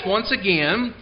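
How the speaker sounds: normal talking voice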